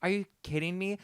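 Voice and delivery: high-pitched, exasperated disbelief; indignant retold outburst; retold frustration. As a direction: replay your frustrated reaction. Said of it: "so mad and just frustrated".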